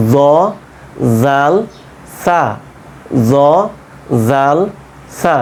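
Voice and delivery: জ, জাল, সা repeated with tongue on upper tooth tips; lisped dental জ, জাল, সা, a gap after each; articulation drill. Shown as a demonstration. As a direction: drill জ, জাল, সা one at a time, tongue to teeth. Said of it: "ওপরের দাঁতের আগা".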